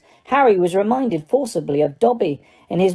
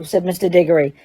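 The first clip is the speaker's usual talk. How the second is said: ordinary delivery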